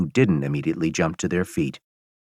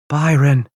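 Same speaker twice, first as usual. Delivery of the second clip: higher, louder happy call of a name; relieved cry; 7 semitones higher, 4 dB louder than usual. Acted. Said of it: "Adriana says".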